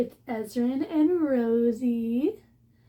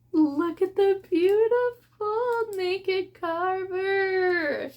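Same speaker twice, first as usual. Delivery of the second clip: higher, slow, stretched-out gush; excited exclaim; 7 semitones higher, much slower than usual. Real, not acted. Showing viewers the naked hardcover, slow excited gush. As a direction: draw every word out in delight.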